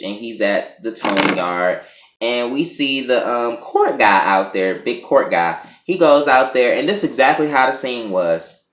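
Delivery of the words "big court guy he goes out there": usual speaking voice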